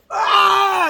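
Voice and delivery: loud, high cry of "ah"; sudden cry. Real, not acted. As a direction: burst out as if suddenly seized. Sudden cry at felt divine hug.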